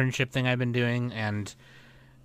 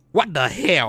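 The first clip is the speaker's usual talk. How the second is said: put-on Vietnamese accent, louder, higher; 5 semitones higher, 4 dB louder, slower than usual. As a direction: mimic a Vietnamese coworker. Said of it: "Sound like my Vietnam".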